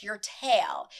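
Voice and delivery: normal talking voice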